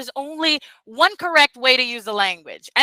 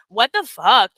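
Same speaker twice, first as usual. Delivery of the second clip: ordinary delivery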